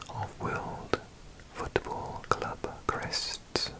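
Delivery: slow, hushed ASMR whisper; soft whisper. Real, not acted. Introducing the football crest series, soft whisper. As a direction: let it sink into a very soft whisper.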